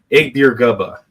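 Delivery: "Igbirgubba" said as ig-BEER-guh-buh; careful name pronunciation. Real, not acted. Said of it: "Got it right".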